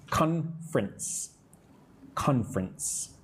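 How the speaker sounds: "Conference" slowly in two beats, CON-frence; two-syllable pronunciation. Shown as a demonstration. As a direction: drop the middle vowel of "Conference", slow and soft. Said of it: "we should pronounce conference as two syllables".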